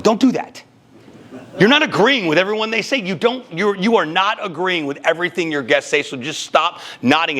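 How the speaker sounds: high, emphatic telling-off; heated scolding. Real, not acted. Host scolding podcasters about nodding, emphatic and high. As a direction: scold the nodding hosts, pitch up.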